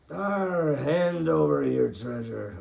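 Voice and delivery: slow, loud growling pirate order; pirate captain. Acted. Pirate captain ordering Jonathan's crew to hand over treasure. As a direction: demand the loot like a pirate. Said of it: "ordered the pirate captain".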